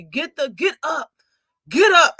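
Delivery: mild call building to a loud high yell. Retold or imitated. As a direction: shout at the screen for him to stand. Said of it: "I was yelling at the T V".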